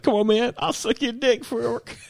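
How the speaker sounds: very high, whiny crackhead plea. Retold or imitated. Crackhead begging the guys walking by. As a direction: squeal it like a desperate crackhead. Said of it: "He's like".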